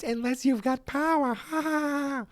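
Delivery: very high put-on goon voice; shriek-like taunt into a drawn-out fake laugh; cackling henchman. Film fan imitates the other gang member, high mocking cackle. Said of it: "And the other goes".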